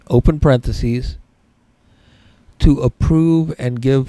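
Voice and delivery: slow dictation with spoken punctuation; dictating. Shown as a demonstration. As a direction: read slowly so students can copy. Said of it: "write the number two".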